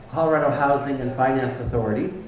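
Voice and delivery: ordinary delivery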